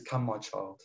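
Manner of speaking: a hint of a loving father lifting a child; softly voicing God's comfort; tender voice of God. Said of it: "the Lord's hand reaches out and says".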